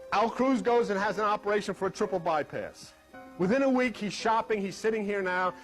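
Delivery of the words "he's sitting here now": ordinary talk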